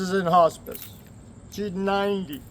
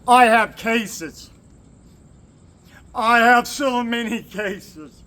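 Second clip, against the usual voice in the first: slow, louder and higher; emphatic call-out; 5 semitones higher, 5 dB louder, much slower than usual. Real, not acted. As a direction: hammer it home to the lawyers. Calling out to lawyers.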